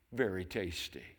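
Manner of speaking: ordinary talk, nothing marked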